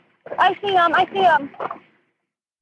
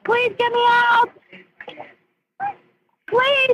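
higher, rising panic; panicked pleading; 6 semitones higher, slower than usual. Real, not acted. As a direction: beg harder, voice climbing. Trapped, begging louder.

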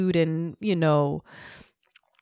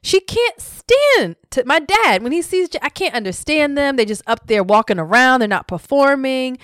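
put-on disapproving old parent; 8 semitones higher, 3 dB louder than usual. Mimicking old-school parents complaining.

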